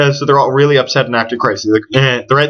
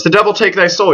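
higher, lightly hammed-up curse; a half-hearted stage shout; mild mock shout; 7 semitones higher than usual. Laertes curses Hamlet in the grave, mild mock shout. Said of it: "he shouts".

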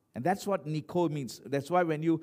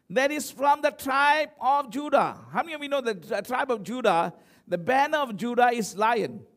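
high, loud sermon delivery; impassioned preaching; 6 semitones higher, 5 dB louder than usual. Real, not acted. Preacher teaches congregation about Judah's lion, raised and forceful. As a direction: drive the point home to the congregation.

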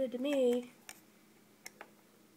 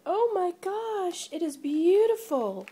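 delighted gush; 7 semitones higher, 6 dB louder than usual. Real, not acted. Admiring the new organizer.

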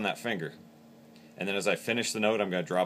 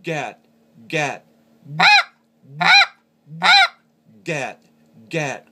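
GAT pitched up, falling away; short high GAT for a goose call; higher pitched voice; 7 semitones higher, 13 dB louder, much slower than usual. Shown as a demonstration. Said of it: "in a higher pitched voice".

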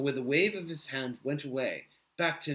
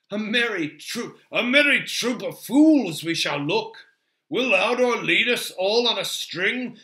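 loud, higher-pitched hearty mockery; lively, jovial scoffing without laughing; amused Gimli; 6 semitones higher, 9 dB louder than usual. A narrator's reading. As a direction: tease with boisterous dwarvish amusement. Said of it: "Gimli laughed suddenly".

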